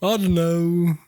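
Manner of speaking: lightly held put-on high singer voice; Walk Hard impression. Host teasing the guest, in a high put-on voice. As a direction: stay in the Walk Hard character voice. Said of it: "your impression is so, uh, walk hard".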